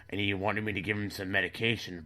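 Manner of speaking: ordinary talk, nothing marked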